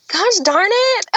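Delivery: high, squeaky mock exasperation; playful groan of defeat; comic exasperation. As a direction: squeal it like caught out by fate. Retold giving in to fate.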